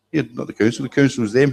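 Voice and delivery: normal talking voice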